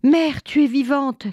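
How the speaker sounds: high-pitched cry of relief; joyful cry. A narrator's reading. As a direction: call out in astonished relief. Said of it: "criaient les fils".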